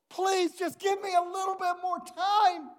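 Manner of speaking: high, strained plea; pleading. Acted. Dave pleads with the king for time, strained and high.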